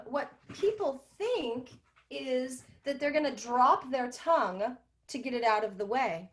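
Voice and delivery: normal talking voice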